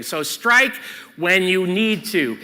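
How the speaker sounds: usual speaking voice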